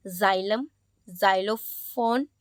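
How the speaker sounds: "जाइलम, जाइलोफोन" each starting with a Z sound. Shown as a demonstration. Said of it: "ज की तरह pronounce". ("जाइलम, जाइलोफोन" में X को ज़ जैसा बोलें)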